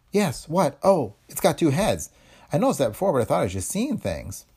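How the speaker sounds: lightly voiced frightened girl; young woman voice.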